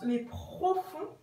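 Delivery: normal talking voice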